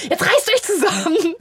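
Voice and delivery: hammed-up high scolding; half-mock high-pitched scolding; mock angry parent. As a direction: play the stressed parent snapping at kids. Mock stressed parent snaps.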